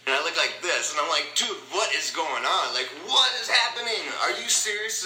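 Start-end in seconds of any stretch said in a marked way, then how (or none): 1.4-5.0 high, loud incredulous outburst; retold "can't believe it" astonishment; disbelieving. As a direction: act out amazed disbelief, pitch climbing. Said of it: "I couldn't believe it".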